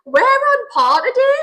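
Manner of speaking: high, loud and slow; excited announcement. Real, not acted. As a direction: proclaim it with a big grin. Hyped intro line.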